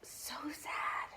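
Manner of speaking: tiny whispered lament; very soft, hushed aside; mock pity. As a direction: whisper it with playful sorrow. Mock pity after imagined parents.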